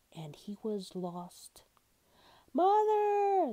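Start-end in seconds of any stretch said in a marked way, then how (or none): 2.5-3.5 high, loud cry for mother; frightened cry. A narrator's reading. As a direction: call out for mother in sudden fear. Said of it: "cried, suddenly afraid".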